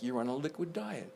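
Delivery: ordinary talk, nothing marked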